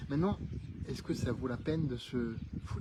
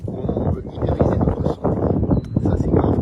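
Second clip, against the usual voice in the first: loud voice raised against the wind; shouting over wind; 10 dB louder than usual. Real, not acted. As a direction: shout to be heard outdoors. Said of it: "j'espère que tu m'entends toujours malgré le vent".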